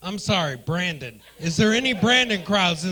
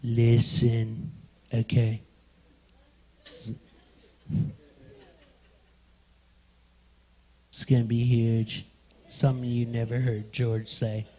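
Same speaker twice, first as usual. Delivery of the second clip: Trump impression; 8 semitones lower than usual. Comic boasting to the crowd in a low impression voice. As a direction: puff up like a bragging president. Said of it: "That was my attempt at an impression".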